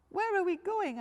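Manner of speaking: high, loud little cub voice; a small bear cub thanking sweetly. Acted.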